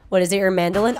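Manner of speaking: ordinary talk, nothing marked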